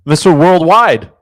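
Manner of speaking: loud, high, gleeful call-out; playful shout. Real, not acted. Streamer to chat, loudly calling out his answer. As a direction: shout the nickname like a hype man. Excited guess while playing hockey trivia.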